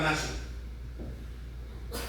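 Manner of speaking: ordinary talk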